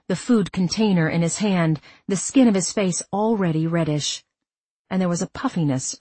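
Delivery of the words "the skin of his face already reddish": usual speaking voice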